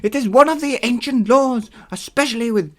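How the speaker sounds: high, loud, drawn-out put-on voice; Hermes. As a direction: declaim the divine rules with heavy ham. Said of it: "Hermes said slowly".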